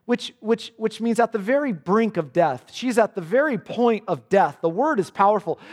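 ordinary delivery

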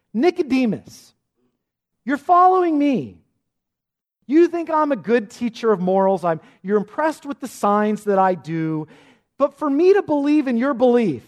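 loud, high, pressing address; emphatic confrontation. Acted. Jesus confronts Nicodemus about his belief, emphatic and loud.

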